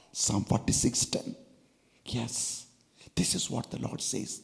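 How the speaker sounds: hushed whisper citing the verse; whispered aside. Real, not acted. Preacher naming the verse under his breath, whispered. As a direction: drop to a whisper for the reference.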